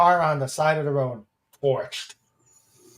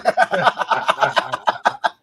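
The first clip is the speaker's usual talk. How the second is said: breathy laugh; 4 semitones higher, 5 dB louder than usual. Real, not acted. Laughing at the joke.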